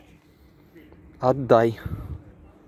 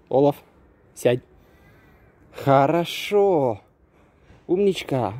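ordinary delivery

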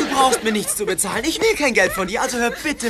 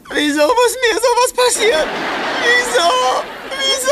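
much higher, loud whining lament; comic despairing howl; despairing wail; 3 dB louder than usual. Acted. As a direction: wail to the heavens in self-pity. Terry laments his lost scholarship.

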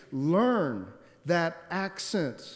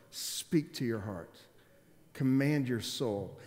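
ordinary talk

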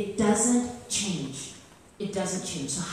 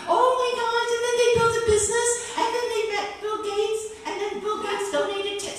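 gushing listener, pitched high and louder; breathless excitement; 9 semitones higher, 7 dB louder than usual. Acted. Eager reader begging a writer for more, excitedly. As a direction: build from eager questions to a high, loud squeal.

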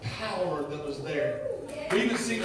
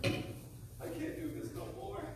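despairing whisper; 10 semitones higher, 11 dB quieter, much slower than usual. Acted. Repeated despairing line.

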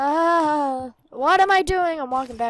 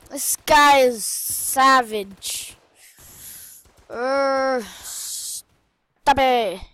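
usual speaking voice

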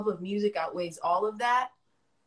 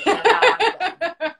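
much higher, louder, slower, laughing; 12 semitones higher, 12 dB louder than usual. Real, not acted. Ending a long tangent to the audience, laughing loudly. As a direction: burst out laughing as you finish.

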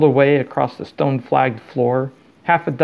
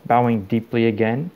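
ordinary delivery

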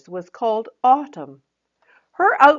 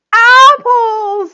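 much higher, very loud cry; excited answer; 15 dB louder than usual. Acted. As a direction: shout the answer with glee. Autumn shouts answer.

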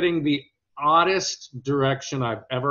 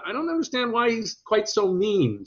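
high, puzzled comedian impression; Jerry; 10 semitones higher than usual. Jerry questions the character's meanness, imitated high voice. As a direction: push the pitch well up to imitate him querying a choice. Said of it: "but he goes".

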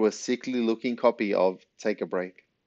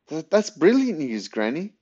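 high, louder, excited stammered start; delighted exclamation; 7 semitones higher, 4 dB louder than usual. A narrator's reading. Boy hearing Granny's good news, exclaiming. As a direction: burst out with excitement. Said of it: "exclaimed Ben".

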